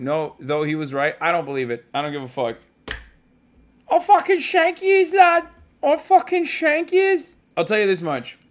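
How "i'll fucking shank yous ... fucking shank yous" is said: high, loud hammed-up Scouse threat; a put-on Scouse accent, pitched far up and shouted; mock Scouse thug. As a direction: play a cartoon Liverpool hardman, way up high.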